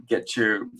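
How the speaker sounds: normal talking voice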